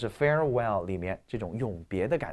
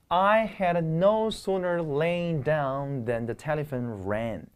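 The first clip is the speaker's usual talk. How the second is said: slow example reading; 4 semitones higher, much slower than usual. Shown as a demonstration. Teacher reads example sentence.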